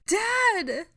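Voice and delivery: very high, loud scream; drawn-out horrified cry; shriek. A narrator's reading. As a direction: shriek for your wounded father. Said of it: "Ginny shrieked".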